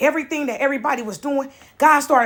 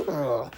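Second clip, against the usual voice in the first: low, soft groan of disgust; disgusted ugh; 13 semitones lower, 11 dB quieter than usual. Retold or imitated. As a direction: groan like a grossed-out daughter. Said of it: "I'm like".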